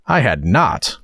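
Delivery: plain reading voice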